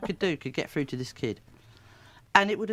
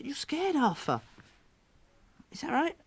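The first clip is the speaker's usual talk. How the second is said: put-on tender high-pitched question to a child; gentle puppet talk; 9 semitones higher than usual.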